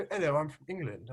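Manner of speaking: a soft, tight-lipped British accent; imitating a Brit with a small mouth. Shown as a demonstration. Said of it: "If you speak British".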